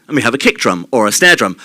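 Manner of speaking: usual speaking voice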